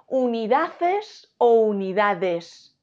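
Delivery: primer "Unidades" acabado en "ces", segundo en "des", lentos; contrapón los dos finales de "Unidades", estirándolos; comparación didáctica. Shown as a demonstration. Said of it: "¿Qué digo?". ("Unidades" por sílabas, primero con ce, luego con de)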